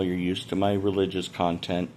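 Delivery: normal talking voice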